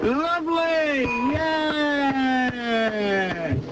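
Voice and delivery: drawn-out high "Lovely!", slow and gleeful; host's delighted high reaction to a joke; high delighted cry. Real, not acted. Host reacts to punchline.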